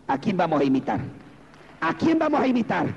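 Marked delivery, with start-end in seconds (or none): 1.8-2.9 raised, high-pitched preaching question; rallying question. Real, not acted. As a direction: throw the challenge out to the congregation. Repeats question to rally congregation. (pregunta exhortativa en tono agudo)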